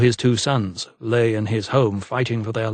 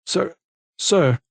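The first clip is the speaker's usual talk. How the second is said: nervous stutter; 6 semitones higher than usual. A narrator's reading. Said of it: "Perkins stuttered nervously".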